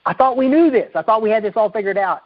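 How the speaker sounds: high-pitched, disbelieving woman's voice; incredulous woman. Retold or imitated. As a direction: voice her bewildered protest up high. Woman quoted exclaiming in disbelief.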